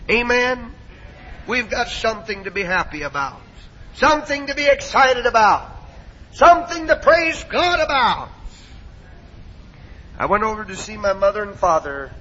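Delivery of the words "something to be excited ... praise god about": rising, high preacher's exhortation; rousing preaching. Real, not acted. Preacher telling the church they have cause to praise, rousing preaching. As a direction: build each phrase higher to stir the crowd. Rallies the congregation.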